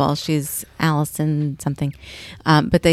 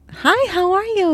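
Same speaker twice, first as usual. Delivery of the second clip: sing-song greeting; slower than usual. Retold or imitated. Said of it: "And I was like".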